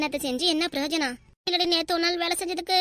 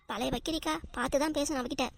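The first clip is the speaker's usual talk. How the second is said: softer gossiping undertone; hushed aside; 7 dB quieter than usual. Acted. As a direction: warn her quietly, out of the other's hearing. Gossip aside about woman present.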